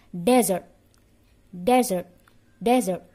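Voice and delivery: loud, separate "Desert", DEZ-ert with a Z; punched-out "Desert" for pupils to copy; repeat-after-me model. Shown as a demonstration. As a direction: drill "Desert" for the class, pausing after each. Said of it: "Repeat after me".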